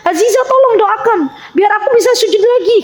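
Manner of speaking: high, weepy plea for prayers; tearful request. Retold or imitated. The weeping teacher asking Azizah to pray for her. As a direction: ask for help through tears. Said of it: "Jadi beliau tuh nangis".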